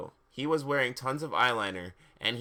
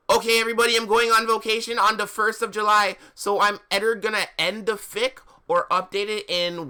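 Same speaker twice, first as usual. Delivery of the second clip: high, loud put-on author; hammed-up author's note voice; fanfic author voice; 9 semitones higher, 8 dB louder than usual. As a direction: gush it at a high pitch.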